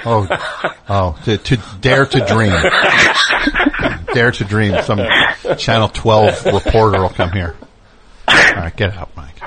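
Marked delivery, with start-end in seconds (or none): 0.0-4.8 giggling through the words; laughing. Real, not acted. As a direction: laugh while saying it.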